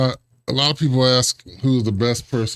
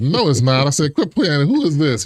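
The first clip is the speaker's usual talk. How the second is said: higher-pitched, insistent protest; acted disbelief; 5 semitones higher, 4 dB louder than usual. Draftee doubting the caller is really Jerry, disbelieving. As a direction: challenge the caller as a prankster. Acts out disbelief at prank-seeming call.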